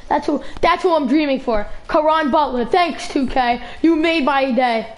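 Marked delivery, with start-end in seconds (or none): none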